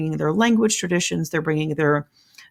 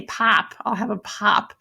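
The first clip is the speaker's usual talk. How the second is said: put-on higher-pitched Chicago accent with a broad "ah"; 4 semitones higher, slower than usual. Teacher imitating cousins in Chicago ordering a drink, Chicago accent. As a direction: imitate Chicago cousins, opening the vowel in "pop". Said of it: "that ah sound".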